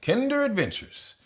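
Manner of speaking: sing-song title. Acted. Announcing series title.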